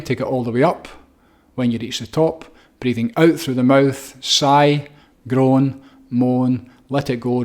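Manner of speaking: calm instructor counting out breaths; guided breathwork. Shown as a demonstration. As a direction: lead them through each breath, unhurried. Guides the breathing exercise aloud.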